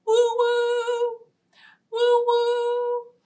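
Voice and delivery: high hooting train whistle woo-woo; playful whistle call. Pretend. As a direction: hoot like a toy train.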